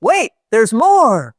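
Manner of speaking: big slow drawn-out pitchman voice; booming commercial pitch, high and loud; infomercial announcer. Retold or imitated. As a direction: sell it like a late-night TV ad. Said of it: "it would always go to".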